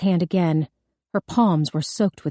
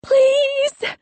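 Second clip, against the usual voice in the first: high, drawn-out desperate plea; wailing yell; 3 dB louder than usual. A narrator's reading. Chained girl begs her parents, wailing. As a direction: beg in a very high girlish cry. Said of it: "she yelled".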